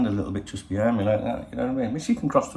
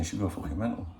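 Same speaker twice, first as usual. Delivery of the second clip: ordinary talk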